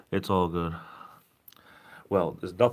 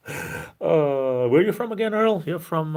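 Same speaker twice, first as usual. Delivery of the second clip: asking Earl where he's from, much higher and louder; calling out high; 9 semitones higher, 6 dB louder, slower than usual. Real, not acted. Asking Earl from the chat, called out high. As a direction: reach up high and call out his name.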